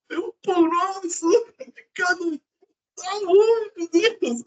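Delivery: imitação de reza chorosa, voz bem aguda; oração chorosa. Retold or imitated. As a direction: reze num lamento agudo e arrastado, caricato. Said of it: "você muda a forma". (imitando súplica chorosa, bem aguda)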